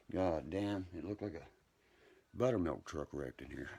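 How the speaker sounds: normal talking voice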